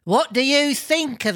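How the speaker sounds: put-on high comic old man asking; old man character. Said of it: "asked the old man".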